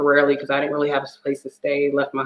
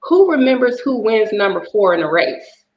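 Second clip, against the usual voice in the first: higher voice of her younger self, building to the question; childhood self; 8 semitones higher than usual. Retold or imitated. Recalling her childhood mindset as the fourth child, higher voiced. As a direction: voice the kid who felt overlooked, higher. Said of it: "'cause I'm like".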